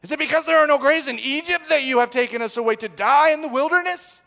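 high, loud panicked outcry; frightened shout. Acted. Terrified people shouting at Moses, loud and high. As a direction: cry out in terror at the leader. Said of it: "They feared greatly and shouted".

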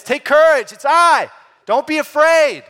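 shouting across a stormy sea to the boat; yelled over wind. Shown as a demonstration. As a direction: call it out over the roaring waves. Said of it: "He probably would have had to, to yell it".

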